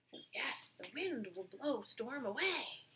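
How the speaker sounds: soft whispered agreement; hushed whisper. Acted. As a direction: murmur it back. Cows echo quietly.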